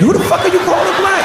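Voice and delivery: high mock outraged question; high indignant punchline shout; indignant yell. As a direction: fire it off as quick comic outrage. First take of the rant line.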